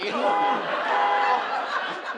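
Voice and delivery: breathy laugh. Acted. Bubbling about starting a family.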